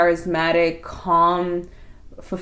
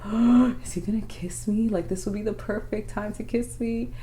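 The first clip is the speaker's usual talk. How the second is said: breathy half-whispered inner thought, pitched up; acted-out giddy anticipation, soft and higher; hushed excitement; 4 semitones higher, 5 dB quieter than usual. Girlfriend recalling her hope of a kiss, hushed excitement.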